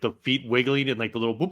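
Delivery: ordinary talk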